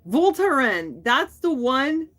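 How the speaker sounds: loud, bright, eureka-like; excited realization. Real, not acted. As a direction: shout it as it finally comes back. Finally remembers the name.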